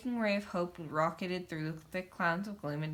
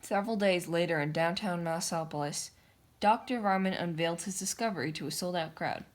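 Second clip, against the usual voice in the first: usual speaking voice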